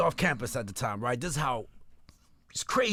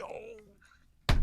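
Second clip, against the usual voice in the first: stunned "oh"; 4 dB quieter than usual. Retold or imitated. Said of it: "we was like".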